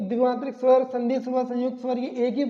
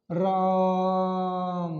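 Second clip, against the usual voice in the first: राम held very long and drawn out, calling far; calling across a field; 4 semitones lower, much slower than usual. Shown as a demonstration. Teacher calling to someone across a field, long drawn call. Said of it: "यानी जोर से बोलेंगे".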